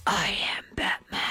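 low whispered Batman growl; Batman impression. Shown as a demonstration. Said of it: "let's hear your Batman impression".

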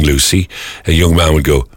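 normal talking voice

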